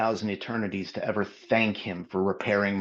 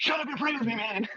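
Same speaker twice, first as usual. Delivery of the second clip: much higher, half-laughing mock command; comic high replay; 13 semitones higher than usual. Laughing retelling of his altar command to Roy, high mock voice. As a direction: replay the line pitched way up for laughs. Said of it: "replayed quote, listener laughing".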